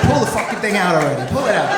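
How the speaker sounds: loud, high, pained exasperation; acted-out fed-up yell; exasperated outburst. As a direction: plead loudly for it to be over. Acted exasperation during painful plucking.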